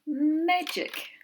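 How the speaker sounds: Magic called out high and loud; excited word call. Shown as a demonstration. Teacher presenting a spelling word to the class, excited. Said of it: "teacher presents word".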